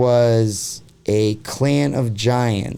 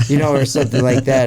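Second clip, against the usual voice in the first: usual speaking voice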